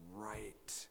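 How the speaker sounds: hushed emphasis; whispered. Real, not acted. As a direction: drop to a sudden whisper on the last word. Whispered punchline on God's judgment.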